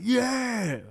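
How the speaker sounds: high, loud drawn-out yeah; mock loud cheer. Imitating loud Americans in bars, high loud cheer. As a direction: cheer like a drunk bar patron. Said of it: "they're really loud".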